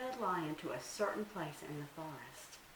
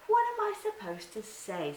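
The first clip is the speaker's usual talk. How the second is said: much higher, louder innocent little deer; Little Bush Deer; 5 dB louder than usual. Acted. Little deer plays innocent to trick Anansi, high and sweet. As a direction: act innocent, a small high voice.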